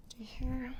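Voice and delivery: soft whisper under breath. Real, not acted. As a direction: whisper it to yourself. Adjuster muttering to himself.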